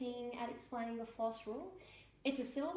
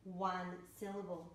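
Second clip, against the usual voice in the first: normal talking voice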